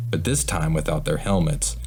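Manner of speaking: usual speaking voice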